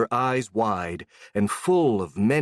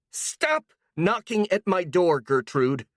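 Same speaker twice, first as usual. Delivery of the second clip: shouting; 8 semitones higher, slower than usual. Acted. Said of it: "she shouted".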